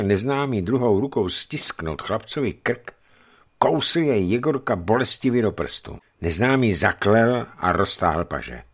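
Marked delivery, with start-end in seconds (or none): none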